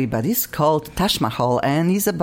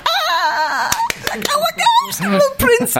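very high-pitched starstruck squeal; swooning fan squeal; slower than usual. Acted. Host imitating ladies hearing the word prince, excited high squeal. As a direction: squeal like fans thrilled to meet royalty. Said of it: "we'll be doing like".

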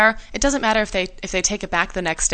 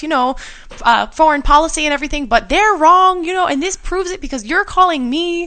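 high, loud folksy accent mimicking Sarah Palin; mock Palin accent; 8 semitones higher, 4 dB louder than usual. Cohost retelling a radio prank, in a mock folksy accent. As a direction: ham up a gushing folksy accent, pitched high. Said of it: "why are you doing an accent for Sarah Palin".

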